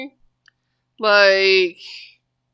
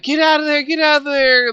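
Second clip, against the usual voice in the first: higher, frantic call to get out; panicked shout; 7 semitones higher, 3 dB louder, much faster than usual. Acted. DM voicing friends outside the door, panicked and high. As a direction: urge them out in a panic. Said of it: "they're panicking".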